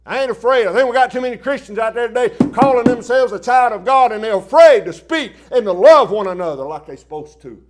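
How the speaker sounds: high, loud street preaching; full-force preaching. Acted. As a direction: thunder it to the crowd.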